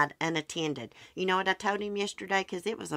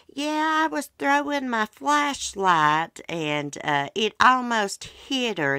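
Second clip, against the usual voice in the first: put-on high, louder childish voice, slowed; imitating the boy; 7 semitones higher, 6 dB louder, slower than usual. Neighbor boy excusing himself for throwing the flashlight, in a high kid voice. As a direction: mimic a little boy making excuses.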